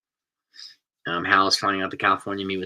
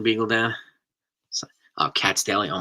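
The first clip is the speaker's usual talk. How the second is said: ordinary talk, nothing marked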